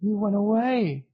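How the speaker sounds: put-on high, teary child voice; small boy near tears; tearful little boy. A narrator's reading. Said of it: "he said tearfully".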